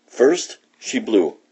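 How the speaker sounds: ordinary delivery, nothing marked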